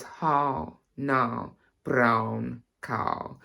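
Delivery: put-on very slow, old-fashioned RP vowels; old-school RP. Shown as a demonstration. Teacher showing how old school RP can go, old-school RP accent. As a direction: stretch each word in old-school Received Pronunciation. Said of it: "if you want to really go old school".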